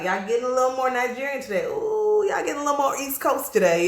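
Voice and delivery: high, lilting mock-surprised remark; teasing onlooker. Voices others noticing the switch.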